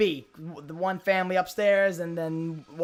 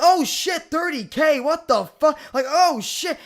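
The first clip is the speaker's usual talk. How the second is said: high, raised mock astonishment; imitated amazement; 7 semitones higher, 5 dB louder than usual. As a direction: react like someone floored by a big number. Imitates people impressed by sub count.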